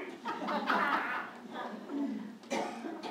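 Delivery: a quiet airy chuckle; soft laugh. Real, not acted. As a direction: chuckle softly to close the story. Laughs at the end of the story.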